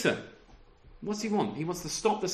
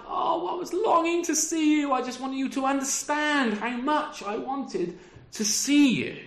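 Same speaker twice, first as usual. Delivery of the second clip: higher, eager, heartfelt; passionate longing; 7 semitones higher than usual. Pretend. Paul longing to see his church, eager and heartfelt. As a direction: pour out how badly you miss them. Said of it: "He says, like".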